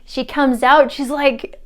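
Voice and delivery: usual speaking voice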